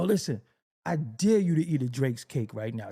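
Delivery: normal talking voice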